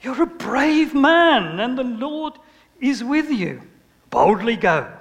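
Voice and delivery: slow, grand angelic voice calling a hero; booming angel. Retold or imitated. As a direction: announce it like a heavenly messenger. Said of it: "So the angel said".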